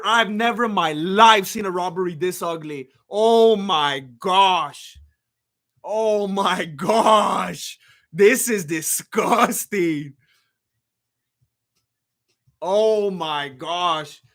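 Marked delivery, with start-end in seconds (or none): 3.1-7.8 drawn-out dismay. Real, not acted. Streamer appalled by the decision, very slow drawn out. 8.1-10.1 drawn out, slowly; slow disgust. Real, not acted. Streamer reacting to a robbery decision, slow disgust. 12.6-14.2 drawn-out dismay. Real, not acted. Streamer appalled by the decision, very slow drawn out.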